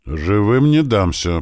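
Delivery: ordinary delivery, nothing marked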